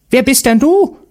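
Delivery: high, loud witch voice, puzzled; a shrill-edged hag asking who's there; wondering witch. A narrator's reading. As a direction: Baba Yaga surprised by the boy. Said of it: "wunderte sich die Baba Yaga".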